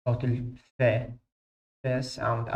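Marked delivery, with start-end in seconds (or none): none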